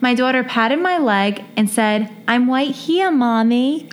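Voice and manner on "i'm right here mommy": put-on higher, cute toddler voice; childish phonetic pronunciation, lifted pitch; cute little child. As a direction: say it like a sweet little daughter reassuring Mommy. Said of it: "I read it really cute".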